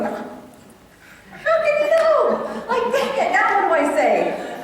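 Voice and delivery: high, drawn-out exasperated cry, breaking toward laughter; flustered mom. Retold or imitated. Mom caught out in the kitchen by her son, comic exasperation. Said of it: "I would always be in the kitchen going".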